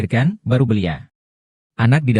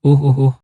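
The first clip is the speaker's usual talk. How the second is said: short loud grunting groans of pain; labour groans; 4 semitones higher, 4 dB louder than usual. Acted. Woman in labour grunting loudly in pain. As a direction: grunt hard through the contraction.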